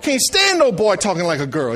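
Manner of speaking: ordinary delivery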